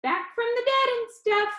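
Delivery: perky showman pitching to his audience; cheerful Danny. Acted. Danny on video teasing the funeral crowd, bright and upbeat.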